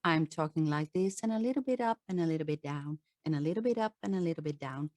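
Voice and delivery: no urgency, just a gentle even murmur; mild, flat intonation. Shown as a demonstration. Coach shows the audience non-urgent speech, fast and soft. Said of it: "If intonation is very mildly".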